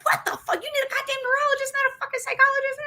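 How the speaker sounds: very high, fast mock outrage; breathless start, then a squeaky rushed rant; imitated pissed-off rant. Behavioral health staff outraged for patient, high mock-angry rant. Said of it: "They're pissed".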